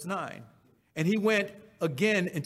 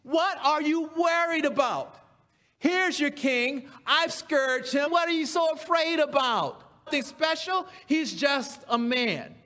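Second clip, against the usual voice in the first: Pilate paraphrased; 8 semitones higher than usual. Retold or imitated. Pilate pleads with the crowd over the scourged king, high and rising. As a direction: play Pilate begging the crowd to calm down. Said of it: "Pilate was saying".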